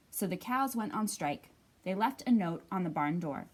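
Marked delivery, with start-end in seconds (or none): none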